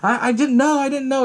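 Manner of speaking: high, whiny mock apology; breaking down, retold. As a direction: imitate a distraught woman pleading forgiveness. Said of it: "she starts breaking down".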